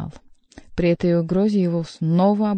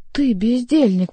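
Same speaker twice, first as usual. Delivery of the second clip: higher, louder angry reproach; indignant rebuke, pitched up; hissed; 5 semitones higher, 5 dB louder than usual. A narrator's reading. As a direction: scold in a tense, voiced hiss. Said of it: "прошипел Генрих".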